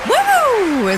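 high whooping cheer; excited cheer. Acted. Cheering the auction result.

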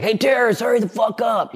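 high, impatient heckler shout; imitating a rude stranger; mock shout. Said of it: "some guy being like".

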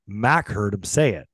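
ordinary talk

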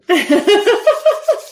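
short bright giggle; high giggle. Real, not acted. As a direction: let out a quick high giggle. Laughs after a joke.

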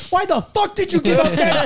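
high mock yell; yelling at server. Cook yelling at server over the sandwich, high mock yell. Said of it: "yelling at my server".

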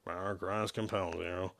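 low, very soft, slurred mumble. Acted. Shepard grudgingly joins the priest's chant, mumbling. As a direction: mumble it reluctantly under your breath. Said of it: "Shepard mumbled the phrase".

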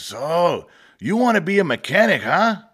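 the Mexican shop boss sizing up the kid, mock Mexican accent; imitating boss's Mexican accent. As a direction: lightly put on the old boss's Mexican accent, teasing. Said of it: "He had a thick, thick Mexican accent".

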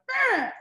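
a high, loud playful buzzing brr; mock kid machine noise. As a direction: imitate busy kids whirring like a machine. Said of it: "they're like".

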